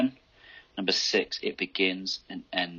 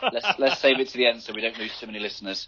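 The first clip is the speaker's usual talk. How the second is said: laughing; 4 semitones higher, faster than usual. Real, not acted. Joking about losing listeners.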